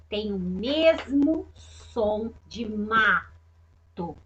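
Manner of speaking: put-on slow, stretched words for children; patient teacher explaining the sound; slow and deliberate. Shown as a demonstration. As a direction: spell it out slowly. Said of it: "teacher stressing the same sound".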